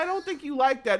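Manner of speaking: mock mom soothing her kid, Johnny; patient parent voice. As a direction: gently talk the kid out of it like a parent. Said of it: "and then there's the adult like".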